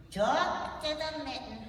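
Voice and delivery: slow, dismissive puppet voice; sassy puppet voice. Acted. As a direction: brush her off like a diva.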